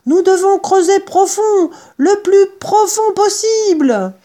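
loud, high-pitched rallying shout; ordering. Acted. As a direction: urge them on at full voice, stretching the end. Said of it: "ordonna Maître Renard".

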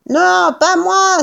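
high, drawn-out refusal; panicked cry. A narrator's reading. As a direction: cry out as you flee. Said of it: "s'écria Buns en prenant la fuite". (cri affolé)

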